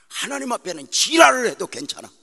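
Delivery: loud, high fiery preaching; preaching shout. Real, not acted. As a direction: blast it at the pastors. Preacher shouting at full force. (목사들에게 힘껏 외치는 설교)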